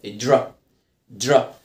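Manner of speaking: low, falling "درا" as a single English dra; DR blend. Shown as a demonstration. Teacher shows how DR sounds, low "درا". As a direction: run d and r together in "درا", pitched low. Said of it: "انطقها كده".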